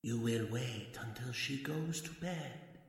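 hypnotic murmur. Acted. Hypnotist softly commanding the entranced husband, hushed. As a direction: lull him into obeying.

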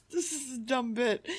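giggling through the line; laughing. Real, not acted. Laughing at own bit.

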